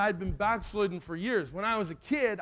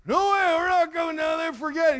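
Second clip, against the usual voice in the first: high, raised mock-panicked refusal; scared defiance; 7 semitones higher, 4 dB louder than usual. Scared boys refuse to climb down, high mock yell. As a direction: yell back a frightened kid's refusal. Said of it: "me and Chris are scared".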